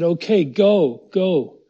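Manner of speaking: loud, drawn-out "go"s, mildly acted; loud retold dismissal. Preacher voicing Pharaoh releasing the people, loud dismissal. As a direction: imitate a ruler giving in and sending them off.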